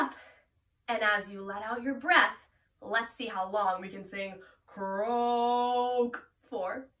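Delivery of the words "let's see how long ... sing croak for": slow. Shown as a demonstration. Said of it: "experimenting with our singing voice".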